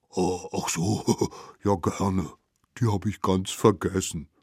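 unhurried, easygoing animal voice; good-natured mammoth. Acted.

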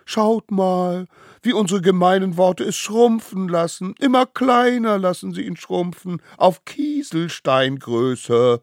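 high, gleeful jeering; teasing kid voice, stretching the last word; gloating mockery. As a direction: show him off as ever tinier. Gang gloats over shrinking victim.

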